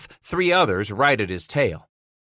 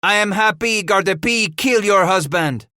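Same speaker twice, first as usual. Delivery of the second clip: high, loud taunt; shouting; 9 semitones higher, 5 dB louder than usual. A narrator's reading. Paul taunts the widow in the stockade, shouting. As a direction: shout it to wound her. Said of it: "Paul shouted".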